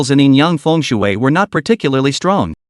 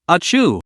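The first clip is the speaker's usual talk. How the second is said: sneeze; 3 semitones higher than usual. Acted. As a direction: let out a sharp sneeze. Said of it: "sneezed and shuddered involuntarily".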